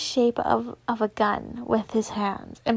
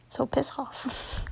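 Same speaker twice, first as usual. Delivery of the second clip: slow playful whisper; 9 dB quieter than usual. Real, not acted. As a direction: tease them in a whisper. Joking whispered brush-off.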